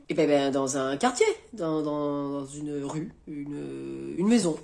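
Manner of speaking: low, very soft stammer; trailing, reluctant admission, barely voiced; evasive hesitation. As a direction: mumble haltingly to dodge the question. Boy evades question about location.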